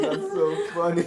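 talking through uncontrollable laughter; can barely get the words out for laughing; laughing helplessly. Real, not acted. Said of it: "Oh, I can't stop laughing".

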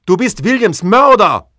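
furious accusing shout, high and loud; outraged yell at the creature. Acted. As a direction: hurl the accusation at him. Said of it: "schreie ich".

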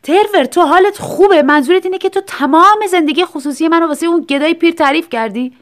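loud stunned fury; angry astonished shout. A narrator's reading. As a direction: yell in shocked outrage at a friend. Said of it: "هیو با خشم و شگفتی داد زد".

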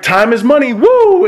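high wrestler's catchphrase with a whoop; Ric Flair. Retold or imitated. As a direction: imitate a showman, ending on a high "woo". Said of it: "And he's like".

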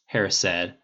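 plain reading voice

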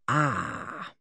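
low, soft, ponderous sound; profound. A narrator's reading. Policeman pondering the motorboat story, profound. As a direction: ponder it in a deep voice. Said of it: "said the policeman profoundly".

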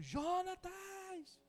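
high, long-drawn call of his name; sister's call. Retold or imitated. Sister in the prayer circle calling Jonatas: high, stretched-out call. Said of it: "a irmã dá um grito".

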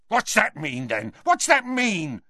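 high-pitched angry demand; yelling, suddenly violent. A narrator's reading. Death Eater demands Dumbledore's meaning, yelling violently. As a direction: flare up suddenly in a high yell. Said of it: "yelled the Death Eater, suddenly violent".

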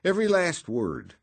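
breezy, all smiles; cheerful. A narrator's reading. Noonan cheerful.

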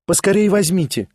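exclamation. A narrator's reading. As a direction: push the portrait away with a cry. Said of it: "воскликнула она".

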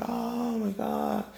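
high, drawn-out fan gush; long, high-pitched "oh my God" of a giddy fan; geeking out. Retold or imitated. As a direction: squeal it slowly like a starstruck fan. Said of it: "I started geeking".

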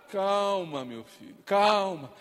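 calm them down gently; soothing calm. Acted. Preacher softly calming a troubled listener, tender hush.

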